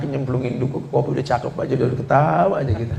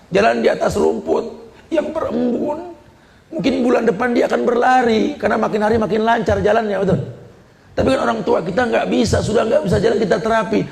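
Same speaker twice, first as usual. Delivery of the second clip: raised, high impassioned sermon voice; fervent preaching; 8 semitones higher than usual. Acted. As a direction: preach it hard to the crowd. Preaching at full force.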